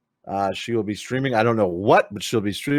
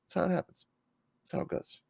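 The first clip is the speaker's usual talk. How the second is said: softer and softer until it is whispered; fading to a whisper; 8 semitones higher, 12 dB quieter, faster than usual. Real, not acted. Streamer trailing off after the pitch, fading to a whisper. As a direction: let it die away into a whisper.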